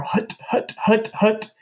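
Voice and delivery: unvoiced hut hut breath pops; whispered hut sounds. Shown as a demonstration. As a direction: push out the hut with the diaphragm.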